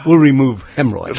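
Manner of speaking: loud preacher parody, breaking into laughter; mock televangelist. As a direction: deliver the preacher punchline, then laugh.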